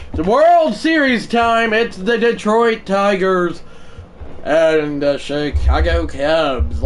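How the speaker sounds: high, loud, slow big-event intro; hyped sportscaster intro; announcer hype. Acted. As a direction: announce it like a TV opener. Hyping the World Series matchup.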